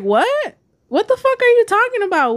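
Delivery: very high-pitched incredulous outburst; retold shocked disbelief. Woman retelling her reply to arresting police, shocked disbelief. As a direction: relive disbelief, pitch leaping up without much added volume.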